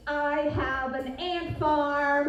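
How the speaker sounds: slow, weighty delivery of the punchline; deadpan proud reveal, slowed down; Shane's emphasis. Retold or imitated. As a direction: lean on FARM like Shane does. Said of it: "He emphasizes things".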